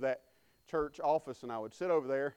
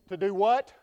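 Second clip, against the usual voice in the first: prompting the crowd, raised and rising; preacher coaxing a louder response; louder call to the congregation; 5 semitones higher, 10 dB louder than usual. Real, not acted. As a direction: call it out to get them answering. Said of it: "Y'all gonna have to say it a little louder".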